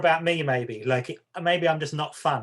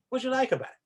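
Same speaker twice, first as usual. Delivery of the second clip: curious question; 10 semitones higher, much faster than usual. Retold or imitated. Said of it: "I'll probably more likely say".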